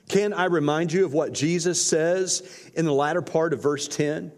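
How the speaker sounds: usual speaking voice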